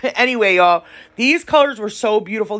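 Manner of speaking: usual speaking voice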